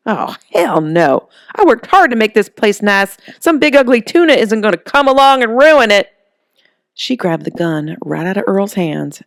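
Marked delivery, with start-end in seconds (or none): 0.1-6.1 furious. Acted. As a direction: defend your home, fury rising. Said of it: "Something inside Holly snapped".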